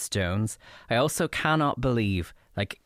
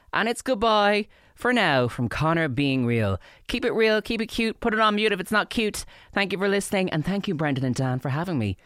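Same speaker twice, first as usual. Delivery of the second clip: high, singsong farewell; playful segment outro, pitched up; cheery sign-off; 10 semitones higher, 3 dB louder than usual. Real, not acted. Signing off his segment.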